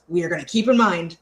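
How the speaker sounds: ordinary talk, nothing marked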